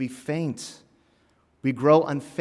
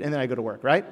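ordinary delivery

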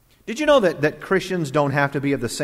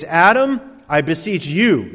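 louder, stress on "Adam" with a rising call; singling out; 3 semitones higher, 5 dB louder than usual. Shown as a demonstration. Preacher singling out a church member by name, pointed. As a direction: call out "Adam" before "I beseech you".

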